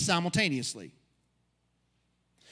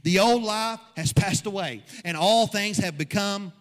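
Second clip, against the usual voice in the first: preaching at full force; 2 semitones higher, 4 dB louder than usual. Acted. Pastor preaches to his church, driving the point home. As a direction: build the congregation up with every line.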